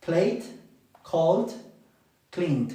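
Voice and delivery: played, called, cleaned closing on a T; ending with T. Shown as a demonstration. Teacher showing verbs with a T ending. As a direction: end each on a T sound. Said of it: "lo estoy pronunciando con la T".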